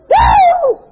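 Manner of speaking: very high, loud "Woo!"; preacher's whoop. Real, not acted. Preacher to congregation, exulting: loud high whoop.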